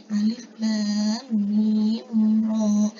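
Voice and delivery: alif lam mim ra slowly elongated; Quranic letter-by-letter recitation. Shown as a demonstration. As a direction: sustain lam, mim and ra on one note. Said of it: "pada bacaan Ar-Ra'd ini".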